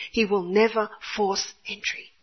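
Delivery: ordinary delivery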